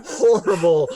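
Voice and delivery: giggling through the words, high and loud; laughing too hard to get it out. Real, not acted. GM describing the Irish accent, cracking up with laughter. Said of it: "horrible stereotype accent".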